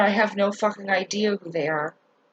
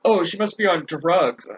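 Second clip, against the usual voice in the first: lower, dismissive voice of people who judge; judgmental onlooker; 6 semitones lower than usual. Retold or imitated. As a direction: sneer the assumption like a gossip. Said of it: "what they usually blame it on".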